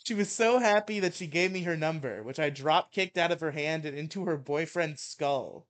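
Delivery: heated mock-angry reading; ranting article read. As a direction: read it like a furious rant. Reads absurd story aloud.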